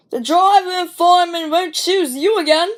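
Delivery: high, loud boastful sneer; big engines boasting. Acted. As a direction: taunt the little engine. Boastful big engines taunting Edward.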